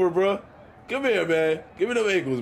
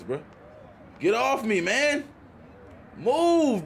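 ordinary talk

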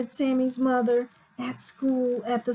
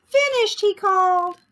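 high, loud proud-pupil call; 9 semitones higher, 6 dB louder than usual. A narrator's reading. Sammy calling to his teacher, high call. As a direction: call it out brightly, pitched way up. He called.

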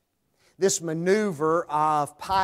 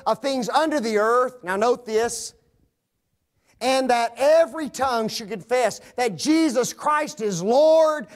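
raised, loud pulpit voice proclaiming scripture; full force preaching; 6 semitones higher, 6 dB louder than usual. Acted. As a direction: ring it out to the back pews.

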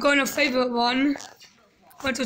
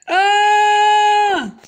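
excited yell; 7 semitones higher, 7 dB louder than usual. Real, not acted. Dropping down slide.

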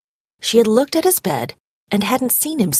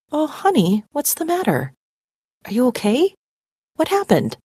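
worried mom; 6 semitones higher than usual. A narrator's reading.

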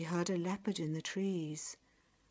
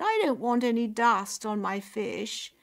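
high, loud petulant whine; haughty, high-pitched grumble; spoilt complaint; 4 semitones higher, 7 dB louder than usual. A narrator's reading. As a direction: fuss like a pampered sister. Frowning spoilt sister complains.

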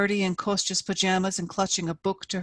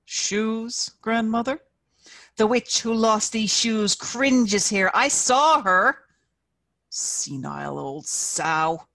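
amused; 3 semitones higher, 5 dB louder, slower than usual. A narrator's reading. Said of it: "Dobrynin sounded amused".

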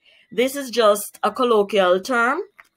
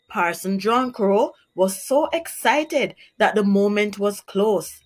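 plain reading voice

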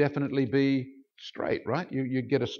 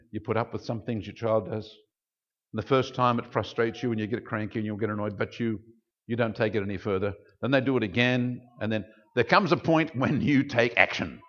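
normal talking voice

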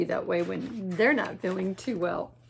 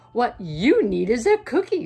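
beaver voice; 8 dB louder than usual. Acted.

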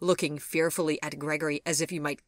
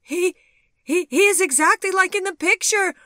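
loud, high-pitched stammering cry; shrieking, disbelieving outburst; horrified cry; 13 dB louder than usual. A narrator's reading. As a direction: cry out in shocked disbelief, stumbling over the words. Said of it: "she cried".

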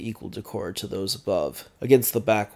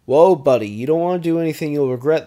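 loud, fast, higher guard's voice; a raised, hurried guard voice; guard's warning; 7 semitones higher, 12 dB louder, faster than usual. A narrator's reading. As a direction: call out a warning to back off. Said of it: "the guard warns".

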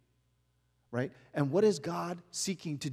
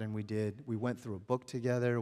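ordinary delivery

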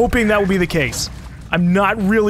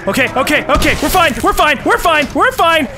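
rising, louder nervous repeating; panicked self-reassurance; 7 semitones higher, 3 dB louder than usual. Real, not acted. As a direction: talk yourself through the panic. Reassuring himself while fleeing.

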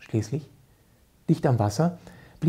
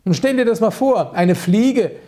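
mock indignation; 8 semitones higher, 5 dB louder than usual.

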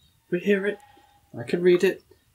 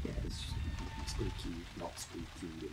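whispering; 9 semitones lower, 22 dB quieter, slower than usual. Real, not acted. Hushed scared commentary.

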